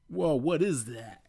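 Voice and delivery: amazed whoa. Acted. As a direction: gape at the airship. Sonic amazed at airship.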